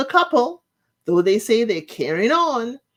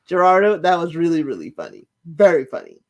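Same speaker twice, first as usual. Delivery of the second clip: laughing; 4 dB louder than usual. Real, not acted. Laughing at his funny line.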